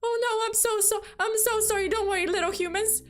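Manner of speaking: high, quick, fussing apologetic alien; apologetic monster. Retold or imitated. Monster apologizes to the scared couple, high and flustered. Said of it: "and they were like".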